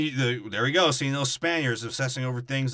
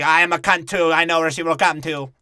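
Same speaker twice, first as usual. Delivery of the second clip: loud, raised-pitch proclamation; mock declaration; 4 semitones higher, 9 dB louder than usual. Crew member volunteering himself, loud mock declaration. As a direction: belt it out like a bad actor.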